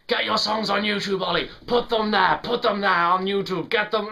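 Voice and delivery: loud, high imitation of a nagging friend; insistent urging. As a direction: badger him loudly, playfully exaggerated. Acts out Michaela urging him.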